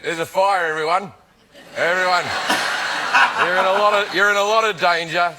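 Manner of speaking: casual Australian fire warning; Australian accent. Acted. Warning everyone of a fire in his own voice, Australian accent. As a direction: announce it in your own easygoing Australian accent. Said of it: "But with my voice, if I just went".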